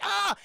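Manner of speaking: loud, high scream of alarm; hammed-up horror-movie scream; mock scream. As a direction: imitate a grabbed victim's yell. Blob grabs Meg's hair.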